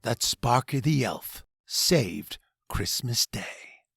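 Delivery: ordinary delivery, nothing marked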